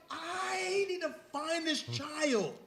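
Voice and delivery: very slow, high and loud; slow determined. Retold or imitated. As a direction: draw each word out with resolve. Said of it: "I was like".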